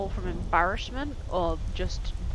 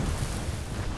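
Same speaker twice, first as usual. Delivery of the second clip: panicked gasp. Real, not acted. Gasp while fleeing.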